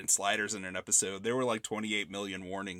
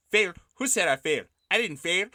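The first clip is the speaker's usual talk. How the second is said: a mild mock of a strange Spanish-tinged accent, a little higher and louder; Jon Voight's accent; 8 semitones higher, 4 dB louder than usual. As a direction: imitate the priest's odd Spanish-flavoured accent, half-laughing. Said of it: "this accent that he puts on in this movie".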